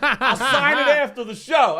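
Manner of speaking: loud, high-pitched indignant holler; imitating a man yelling across a room; retold yelling. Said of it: "So he's yelling".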